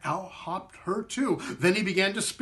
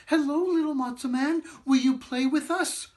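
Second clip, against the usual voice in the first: put-on eager children; 9 semitones higher than usual. Children greet the matzah man.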